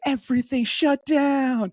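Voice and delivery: high, drawn-out proclamation of doom; mock panic. Guest mimicking D C over one inch, mock-alarmed high voice. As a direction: exaggerate a city-wide panic, slow and high.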